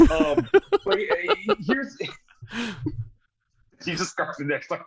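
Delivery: words break up in high laughter; laughing. Real, not acted. A host cracking up mid-sentence over his slip, laughing. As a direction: try to talk through a laughing fit.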